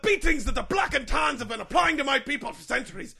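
loud, high indignant rant; heated rant. Acted. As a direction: build to a furious tirade. Mascot ranting again.

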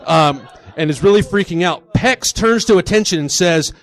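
usual speaking voice

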